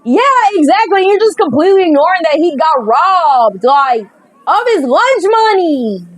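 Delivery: ordinary talk, nothing marked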